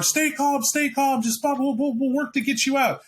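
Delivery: high, hurried attempt to calm the trapped passengers; reassuring cop. Patrol cop calls to trapped passengers, high and hurried reassurance. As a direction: soothe them fast while you fumble at the door.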